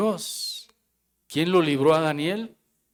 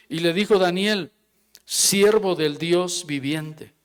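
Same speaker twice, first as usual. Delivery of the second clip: measured Bible reading, the king's words slowed; scripture reading; 4 semitones higher, 4 dB louder than usual. Acted. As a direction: read the verse with weight. Reading continues.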